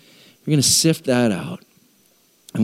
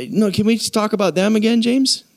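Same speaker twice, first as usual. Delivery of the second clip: higher, fast pleading whine; whiny churchgoer; 8 semitones higher than usual. Acted. Congregant pleading with James to drop it, whining. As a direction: beg to change the subject. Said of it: "And we're like".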